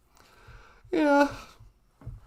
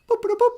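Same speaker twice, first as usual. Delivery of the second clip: computer boops; 8 semitones higher, 4 dB louder, faster than usual. Pretend. Imitating computer beeps to holodeck.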